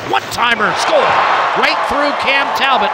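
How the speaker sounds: rising, shouting the goal; excited goal call. Real, not acted. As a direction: call the goal with a big lift.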